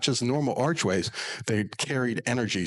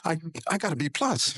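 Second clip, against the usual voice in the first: pleading daughter; 8 semitones higher than usual. Retold or imitated. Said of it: "And she was very angry".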